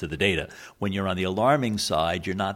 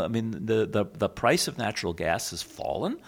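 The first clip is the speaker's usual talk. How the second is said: ordinary talk, nothing marked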